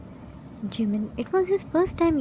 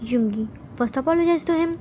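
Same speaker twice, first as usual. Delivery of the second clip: plain reading voice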